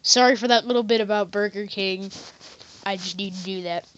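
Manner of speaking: normal talking voice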